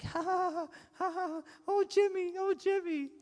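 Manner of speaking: very high-pitched squealing mockery; gushing friends. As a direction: squeal like a swooning fan club. Friends fawning at campus, acted.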